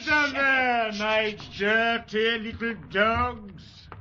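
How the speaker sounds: slow, high, mocking drawl; sneering sergeant. Sergeant Lejeune taunting his new recruits, slow and sneering. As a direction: savour every insult.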